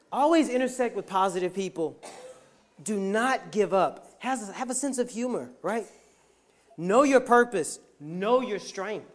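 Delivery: punchy motivational charge; preaching the takeaways. Acted. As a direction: drive each point home like a preacher.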